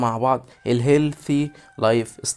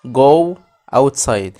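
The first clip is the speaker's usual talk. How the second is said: slow drilled model; 7 dB louder, much slower than usual. Shown as a demonstration. Teacher drilling kids in English, slow loud model. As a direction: Go outside dragged out slow and loud, GO stressed. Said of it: "يعني اذهب للخارج".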